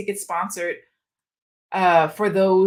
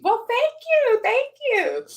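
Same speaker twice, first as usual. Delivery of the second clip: delighted thanks; 4 dB louder, slower than usual. Real, not acted. Flattered by viewer compliment.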